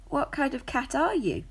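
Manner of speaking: a small cat asking, wide-eyed; high young cat voice. A narrator's reading.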